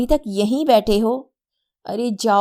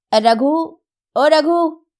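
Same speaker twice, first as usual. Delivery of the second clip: loud, higher call for the servant; 6 semitones higher, 5 dB louder, slower than usual. A narrator's reading. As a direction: shout for Raghu from inside. Calling the servant.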